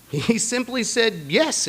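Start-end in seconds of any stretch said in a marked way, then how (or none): 1.3-1.7 put-on a higher "Yes" in a thick French accent; Jean-Claude's thick French accent. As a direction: answer like a French volunteer, French accent heavy. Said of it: "in a very thick French accent".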